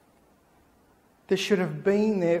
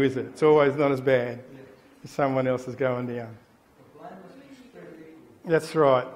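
ordinary talk

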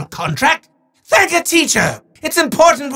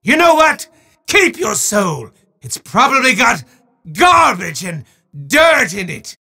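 booming sore-loser wizard, shouting his protests; a theatrical villain ranting at the player; hammy evil wizard, outraged then mocking; gloating wizard villain.

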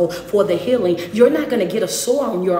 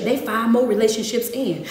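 ordinary talk

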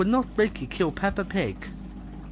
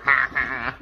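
usual speaking voice

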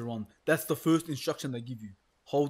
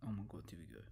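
much lower and softer, hushed; hushed dread; 8 semitones lower, 12 dB quieter, faster than usual. Real, not acted. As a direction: breathe it out in dread. Tension building in horror game.

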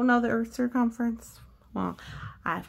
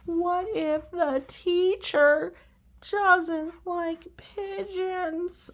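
higher, slow fretful pigeon voice; worried pigeon; 9 semitones higher, 5 dB louder, much slower than usual. Acted. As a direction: draw it out in worry.

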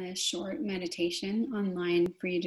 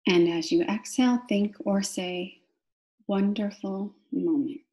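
guided meditation. Real, not acted. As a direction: draw out each cue word, pausing for the breath. Guides breathing with key words.

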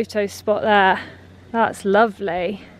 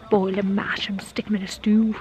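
hushed hobbit whisper; 4 dB quieter than usual. Pretend. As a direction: breathe it like a hungry hobbit. Hobbit food quote, hushed.

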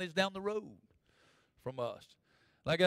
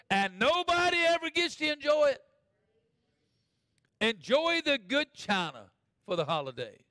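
high pulpit exhortation rising to a cry; full-force preaching; 7 semitones higher, 5 dB louder than usual. Real, not acted. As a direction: urge them, climbing higher to the end.

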